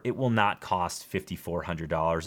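usual speaking voice

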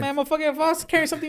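high-pitched acted protest; mock indignant retort. Imagined retort to a suspicious cop, indignant.

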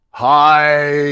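a big loud friendly hi; booming cheerful hi. Retold or imitated. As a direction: call out hi across the train. Greeting staring couple in costume.

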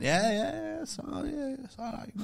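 high voice dropping away softly; imitated evasive reply. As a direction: squirm out of it in a small, high voice. Acted non-committal answer to organiser.

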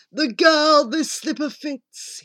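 lightly voiced, declaiming prince; a touch of theatrical proclamation; prince's cry. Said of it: "he cried".

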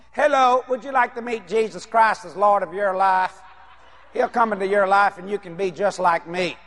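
low, monotone mock door-step pitch; hammed-up dull evangelizing; boring religious visitor. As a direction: recite the invitation like a bored churchgoer. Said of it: "Religion's very boring".

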